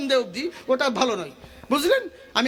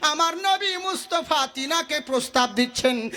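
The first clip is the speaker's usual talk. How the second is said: higher, slow intoned storytelling; melodic preaching; 5 semitones higher than usual. Acted. Preacher telling of companion with no clothes, intoned narration. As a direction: chant the story with stretched phrases.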